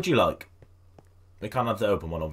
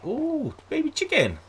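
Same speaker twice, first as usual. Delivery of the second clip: delighted coo; slower than usual. Real, not acted. Sees a baby chicken.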